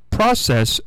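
process said "PRAH-sess"; common short-o pronunciation. Shown as a demonstration. Host admitting how we say it, process with "ah". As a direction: open ah in process. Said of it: "might be how we say it".

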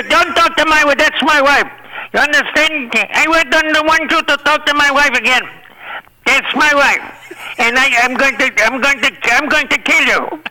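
high-pitched mock Hervé warning; imitating jealous Hervé. Hervé warns Shecky off his wife, high mock voice. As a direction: squeak it up like a jealous little man. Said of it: "And he says".

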